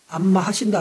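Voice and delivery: usual speaking voice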